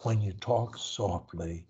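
slow, low and hushed; soft voice. Shown as a demonstration. Coach showing a client low-pressure talk, slow soft voice. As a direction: talk softly and slowly, pitched low. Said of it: "I'm in my soft voice now".

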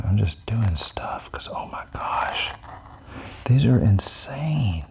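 hushed, slow whisper; whispered amazement; awed whisper. Real, not acted. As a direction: breathe the words in awe. Drawing while amazed.

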